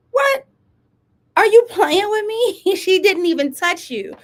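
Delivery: high, loud disbelief; indignant, voice shooting way up with a laugh; incredulous outburst. Real, not acted. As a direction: exclaim it like you cannot believe the nerve. Incredulous at daughter's claim.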